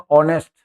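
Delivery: ऑनेस्ट with the H dropped; silent H. Shown as a demonstration. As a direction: open ऑनेस्ट on a clean O vowel. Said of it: "एच को छोड़ने के बाद". (ऑनेस्ट, एच छोड़कर)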